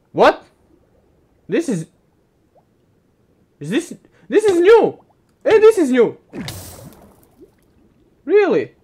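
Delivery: high excited discovery; surprised. Real, not acted. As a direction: exclaim at a new find. Excited find in game.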